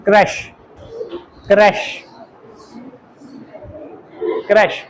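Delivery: क्रश with short e, soft and deliberate; क्रश modeled for students; repeated model. Shown as a demonstration. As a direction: drill क्रश slowly. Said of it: "pronounce कैसे करना".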